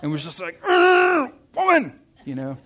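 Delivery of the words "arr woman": high, loud mock growl and yell; growl, then burst out in a mock-angry shout; hammed-up sibling outburst; imitating angry brother. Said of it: "he got angry at her".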